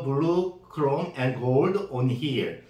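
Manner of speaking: ordinary talk, nothing marked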